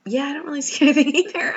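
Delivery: high, loud and fast, laughing through it; laughing agreement. Retold or imitated. Agreeing with the woman that there's no scratch, laughing. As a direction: giggle with relief as you agree. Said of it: "and I'm like".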